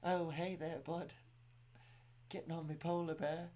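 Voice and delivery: a New Zealander doing a hint of Canadian accent; Dan Hooker Canadian accent. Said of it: "Can you imagine Dan Hooker?".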